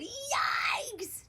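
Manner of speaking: high, startled yikes; dad's horrified cry; alarmed yelp. A narrator's reading. Father reacts to the cart.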